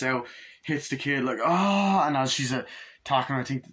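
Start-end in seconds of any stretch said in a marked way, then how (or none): 1.4-2.1 high, loud imitated cry; mock yell. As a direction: cry out as if hit. Kid hit in retold scene.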